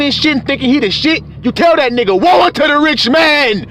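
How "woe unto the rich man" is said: higher, forceful scripture cry; preached rebuke. Acted. Preacher telling off a rich man, forceful rebuke. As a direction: proclaim it as a rebuke.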